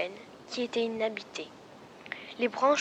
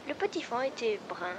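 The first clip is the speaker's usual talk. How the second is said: ordinary delivery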